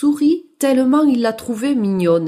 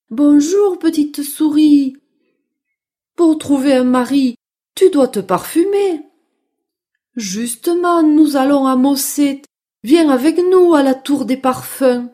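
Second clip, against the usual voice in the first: louder, higher storybook sheep voice; helpful sheep; 3 semitones higher, 6 dB louder than usual. Acted. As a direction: play a friendly bleating sheep giving advice. (voix de brebis aimable)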